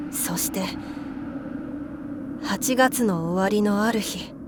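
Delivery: plain reading voice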